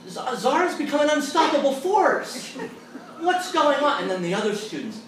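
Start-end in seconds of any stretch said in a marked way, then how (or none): 0.1-4.0 high-pitched mock astonishment; amazed exclamation. Professor reacting to a student's sudden conversion, high and astonished.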